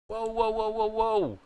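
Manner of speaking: high-pitched string of whoas in real shock. Real, not acted. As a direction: yelp it out, caught completely off guard. Shocked by transfer news.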